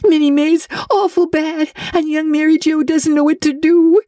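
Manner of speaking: high, breathless and tearful, slowing to a sob at the end; a scared child blurting out bad news; frightened girl. Diana panics, sister sick.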